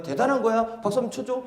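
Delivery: ordinary talk, nothing marked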